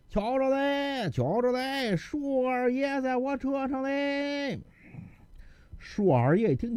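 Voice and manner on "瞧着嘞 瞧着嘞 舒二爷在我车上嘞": high, slow drawn-out street cry; calling out. A narrator's reading. Carter proudly calling out from his donkey cart. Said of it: "一边摇着一边喊".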